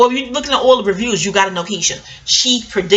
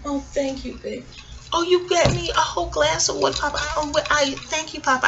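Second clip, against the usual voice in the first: sweet thanks; 8 semitones higher, 5 dB quieter than usual. Real, not acted. Husband brings her water.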